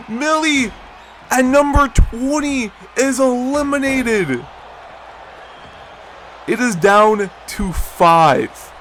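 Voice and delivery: slow, drawn-out big-moment announcement; dramatic announcer. Acted. Commentator announcing five remain, slow and dramatic. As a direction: let each word land like a ring announcer.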